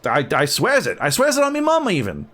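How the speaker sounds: high, fast and emphatic; gruff woman pleading her case to the guard; gruff woman swearing. Acted. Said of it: "she says".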